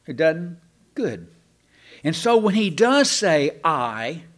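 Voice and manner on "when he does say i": "does" pushed high and loud, then "I" drawn out; emphatic. Shown as a demonstration. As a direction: punch "does", then land on a long "I". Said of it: "it's being emphatic".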